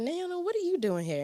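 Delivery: lightly voiced officer who knows her; a hint of a shocked school officer; surprised officer. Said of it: "She was like".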